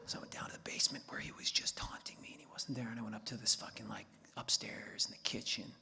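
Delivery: hushed sneaking whisper. Real, not acted. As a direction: whisper so nobody wakes. Sneaking through silent house.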